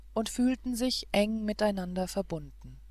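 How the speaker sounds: usual speaking voice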